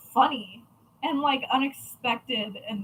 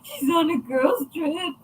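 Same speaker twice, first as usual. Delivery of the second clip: a slow, higher mock whimper of a crying little boy; imitating sobbing child; 4 semitones higher, slower than usual. Little nephew crying to aunt about his mom, imitated sob. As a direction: retell the nephew's slow, weepy complaint. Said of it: "And he was just sobbing".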